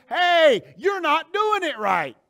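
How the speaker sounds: high, loud, drawn-out scolding; screaming rebuke. Acted. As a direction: shout it slowly as a sharp wake-up call. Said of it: "it screams to me and says".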